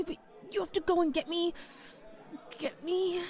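normal talking voice

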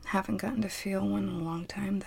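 quick hushed whisper; a fast whispered aside. Acted.

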